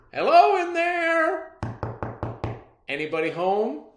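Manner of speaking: high, loud holler, easing on the question; calling out. Acted. Father calling down into the cellar, hearty shout. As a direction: call through a closed door.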